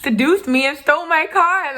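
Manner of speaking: high-pitched indignant confrontation, rising at the end; indignant demand. Retold or imitated. Confronting the friend who took her car, high indignant demand. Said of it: "I'm like".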